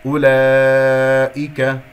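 أولئك with a long loud held aa; slow, loud recited أولئك; obligatory madd. Shown as a demonstration. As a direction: sustain laa in أولئك before the hamza. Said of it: "مد واجب متصل في كلمة أولئك".

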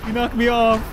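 high hurt yelps; pained yelps. Real, not acted. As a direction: yelp as you get hit. Being hit in fight.

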